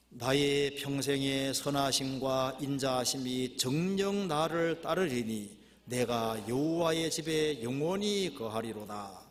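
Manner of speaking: slow, drawn-out congregational reading; scripture reading. Acted. Preacher reading verse six with the congregation, solemn slow recitation. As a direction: intone the verse in long phrases.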